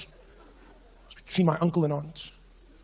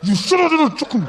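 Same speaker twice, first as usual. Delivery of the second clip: angry outburst; 9 semitones higher, 6 dB louder than usual. Retold or imitated. Back to fight.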